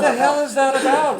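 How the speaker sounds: high, loud, laughing exasperation; mock outrage. Trainer joking about the missing sustain pedal, laughing mock outrage. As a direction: cry it out high through laughter.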